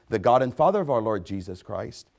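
ordinary talk

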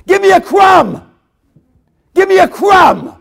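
full-force high screamed plea; shrieked begging, drawn out; desperate scream. Acted. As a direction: scream it out like a beggar at breaking point. Acting the desperate woman begging.

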